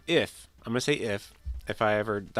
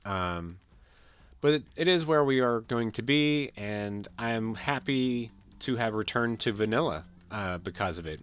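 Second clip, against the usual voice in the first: ordinary talk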